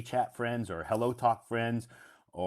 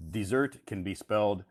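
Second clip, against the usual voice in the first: ordinary talk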